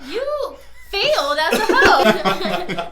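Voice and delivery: loud, drawn-out, through laughter; loud mock verdict. Real, not acted. Host roasting a singer as co-hosts laugh, loud mocking. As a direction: pronounce the failure loudly, stretching it out.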